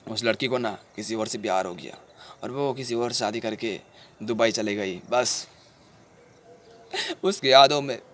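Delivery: low, heavy-hearted telling; sorrowful recounting. Acted. Telling sahib how the girl left him, sorrowful. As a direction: tell it low, with pauses of regret. Acted heartbreak of the abandoned friend.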